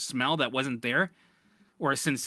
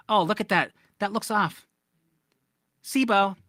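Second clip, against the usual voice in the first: mocking doctors; 6 semitones higher, 5 dB louder than usual. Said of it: "they go".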